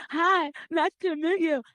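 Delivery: slow, high mock-polite greeting; mimicking Robin. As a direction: stretch it out, high and sweet. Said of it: "she's like".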